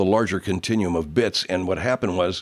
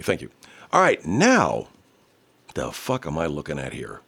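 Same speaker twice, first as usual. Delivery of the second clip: ordinary talk, nothing marked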